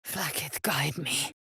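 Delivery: soft hushed plea; quiet whispered plea; whispered prayer. Acted. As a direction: pray under your breath.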